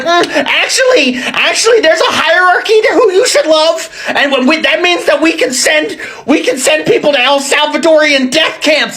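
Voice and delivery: сноб-зануда, высокий насмешливый голос; mocking whiny Vance voice.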